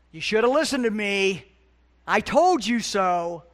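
emphatic mock reproach from a parent; scolding parent. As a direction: scold the kids loudly, stretching it out. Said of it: "I've done that with my parenting".

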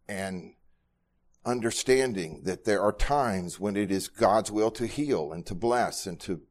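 ordinary delivery

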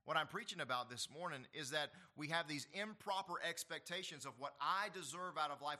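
ordinary delivery

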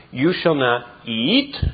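slow, with eat weighted and held; stress on eat. Shown as a demonstration. Pastor reading the serpent's words, stressing eat. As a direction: land hard on the word eat. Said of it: "emphasis on eat".

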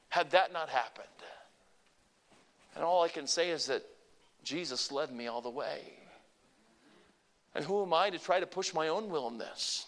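ordinary delivery

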